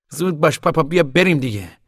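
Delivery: loud, high-pitched hurry-up call; urging. Acted. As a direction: hustle him along, impatient to go. Son hurries father to leave.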